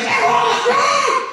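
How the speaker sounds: very high, frantic yell; high-pitched screamed command; mock panicked shout. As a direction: imitate a sheriff freaking out. Acts out sheriff bursting in.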